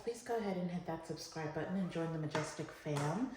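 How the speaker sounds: usual speaking voice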